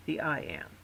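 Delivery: ordinary talk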